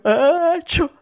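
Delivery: loud, high "ecciù" sneeze; big acted sneeze. A narrator's reading. Mangiafuoco starting to sneeze, loud high mock sneeze. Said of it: "si mise a starnutire".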